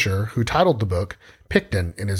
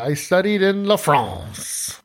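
mock French chef; 6 semitones higher, slower than usual. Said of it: "Chef Jaquil".

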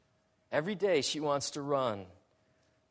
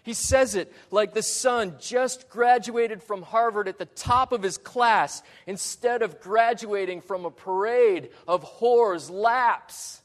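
high, loud, building preacher's exultation; exultant pride; 6 semitones higher, 6 dB louder than usual. Acted. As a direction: crow over the prodigal as if he were a star. Preacher mimics father's boundless pride.

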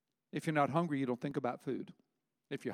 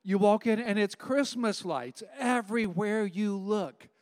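excited description; 5 semitones higher, 6 dB louder, slower than usual. Real, not acted. Excited describing Christmas-lit restaurant.

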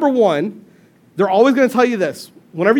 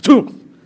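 a sudden mock thud; playful onomatopoeia; thud sound effect; 5 semitones higher, 5 dB louder than usual. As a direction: blurt it like a joint popping. Sound of ankle giving way.

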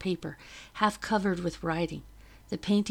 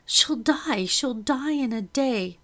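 higher, louder anguished wail, slowing at the end; panicked cry; 5 semitones higher, 6 dB louder, slower than usual. A narrator's reading. Narrator fearing for Ruth's life, anguished cry. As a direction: cry out in despair.

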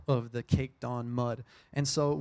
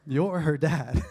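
laughing repeat; 6 dB louder, slower than usual. Real, not acted. Repeats quote while laughing.

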